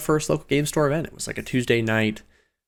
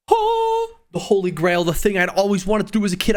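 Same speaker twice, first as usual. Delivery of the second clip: excited; 7 semitones higher than usual. Real, not acted. Recalling the dream of drafting.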